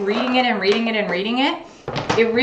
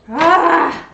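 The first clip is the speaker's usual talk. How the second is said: loud high pained ah; sharp cry; 8 semitones higher, 7 dB louder than usual. Real, not acted. Eyes stinging from onions.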